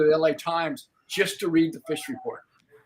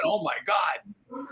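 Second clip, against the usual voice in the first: slow, drawn-out amazed oh my God; awed exclamation; 2 semitones higher, 4 dB quieter, much slower than usual. Real, not acted. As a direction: let it out in remembered awe. Remembering yellowfin frenzy.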